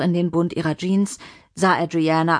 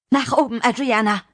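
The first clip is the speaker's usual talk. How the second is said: higher, alarmed command; urgent, fearful order; 5 semitones higher than usual. Acted. Mimi whirling round to send Adriana up, urgent. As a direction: urge the child away in alarm.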